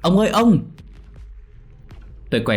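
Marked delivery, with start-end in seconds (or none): 0.0-0.6 high, loud calls for grandpa; panicked shouting. A narrator's reading. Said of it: "Tôi hét lên". (hét gọi ông thất thanh)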